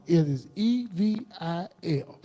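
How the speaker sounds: E V I L spelled out letter by letter, slowly; "E V I L" said as separate slow letters. Real, not acted. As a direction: spell "E V I L" out slow and heavy for weight.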